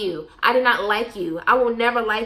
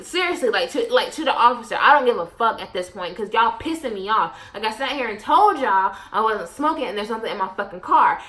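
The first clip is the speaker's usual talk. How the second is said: usual speaking voice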